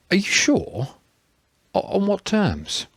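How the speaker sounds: high, halting, incredulous questions; stunned disbelief. A narrator's reading. Thomas stunned by political news.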